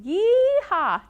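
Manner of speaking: high whooping cowboy yeehaw. Shown as a demonstration. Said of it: "like a cowboy".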